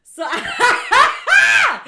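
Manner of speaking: loud, high laughing burst; laughing shout. Real, not acted. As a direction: cracks up loudly mid-sentence. Bursts out laughing mid-story.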